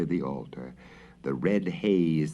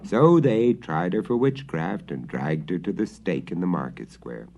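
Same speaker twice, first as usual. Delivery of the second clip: usual speaking voice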